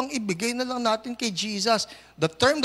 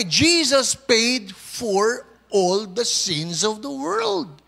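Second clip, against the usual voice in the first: loud, slow preaching; preaching at full force; 2 semitones higher, 4 dB louder, slower than usual. Acted. Pastor proclaiming to congregation, loud and slow. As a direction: proclaim it to the whole church.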